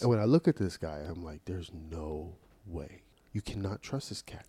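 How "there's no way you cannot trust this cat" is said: slow, low, hushed verdict; retold disbelief. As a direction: say it slowly under your breath. Quoting own disbelieving first reaction.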